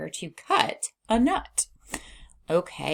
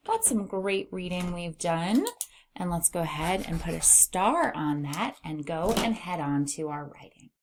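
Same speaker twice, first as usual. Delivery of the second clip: ordinary talk, nothing marked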